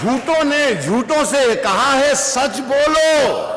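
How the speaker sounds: high, drawn-out recital lilt; poetry recitation. Acted. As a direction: chant the couplet to the crowd. Poet recites couplet, sung lilt.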